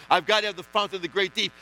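normal talking voice

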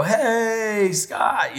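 high, drawn-out happy hello; imitating a dad's glad call; excited greeting. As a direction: greet your son with delight as he walks in. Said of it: "excited to see me".

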